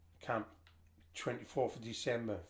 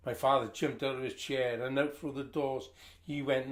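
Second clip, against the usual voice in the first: ordinary delivery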